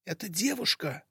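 urgent stage whisper; loud whisper. Acted. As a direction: hiss it out eagerly but hushed. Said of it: "громким шёпотом произнёс Валик".

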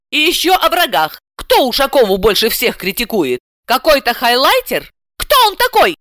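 high-pitched suspicious questioning; indignant housewife. A narrator's reading. Housewife wondering who her critic is, indignant. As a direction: rise sharply into outraged questions. Heroine's inner monologue voiced.

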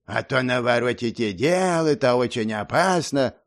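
lightly imitating a smooth-talking mentor; a hint of a coaxing teacher; insinuating mentor voice. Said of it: "скопировал вкрадчивый голос наставника".

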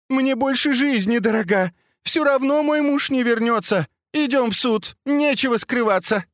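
high frantic wailing cries; hysterical screaming. Acted. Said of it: "истошными криками".

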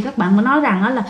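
usual speaking voice

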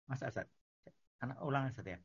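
sounding far off, moving away; walking away. Real, not acted. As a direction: voice fading far from the mic. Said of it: "Ini suaranya jauh banget".

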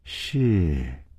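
low, soft obedient reply; respectful assent. A narrator's reading. Disciples who dare not question the order, respectful low assent. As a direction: answer deferentially, voice dropped. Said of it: "他们只是躬声地说".

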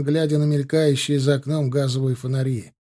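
plain reading voice

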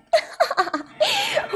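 high giggling fit; helpless laughter. Acted. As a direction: laugh uncontrollably. Sister can't stop laughing. (ضحك لا يُقاوم)

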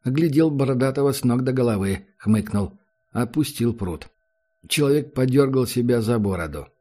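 usual speaking voice

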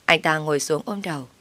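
ordinary delivery, nothing marked

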